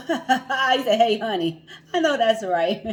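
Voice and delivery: loud, high, laughing banter; laughing greeting. Real, not acted. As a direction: greet playfully through laughter. Joking greeting to viewer, laughing.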